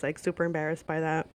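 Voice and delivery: plain reading voice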